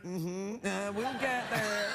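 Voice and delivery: slow, lazy and unhurried; slow unbothered drawl. Retold or imitated. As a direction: take your sweet time saying it. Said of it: "I'm like".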